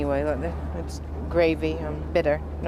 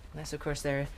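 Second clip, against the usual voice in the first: coy softening; 8 dB quieter than usual. Acted. Softens for Cockney.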